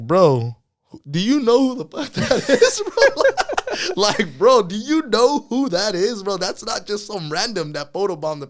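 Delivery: high, loud incredulous retort, laughing through it; mock outrage, voice shooting up; indignant disbelief. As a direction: retell it as an outraged, high-pitched challenge. Retells indignant reply to follower.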